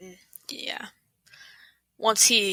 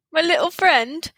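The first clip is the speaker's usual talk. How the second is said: light posh-British send-up, a bit higher; half-laughing British impression; mock British; 6 semitones higher than usual. Echoing the joke back, light mock British accent. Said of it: "in that accent".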